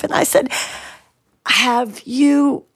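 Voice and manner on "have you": each word drawn out, very slow and careful. Retold or imitated. Daughter nervously asking her father the big question, very slow. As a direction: ease the question out slowly, bracing yourself. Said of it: "I said it so slowly".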